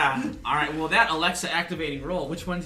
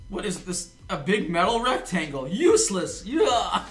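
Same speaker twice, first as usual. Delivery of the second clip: mock outraged outburst; 6 semitones higher, 7 dB louder, slower than usual. Tossing away rocket launcher.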